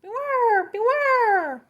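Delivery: very high, loud cawing call; shrill bird alarm cry; crow's warning. Acted. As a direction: screech it like a crow. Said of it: "the crow is cawing".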